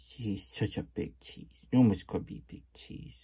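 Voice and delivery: ordinary talk, nothing marked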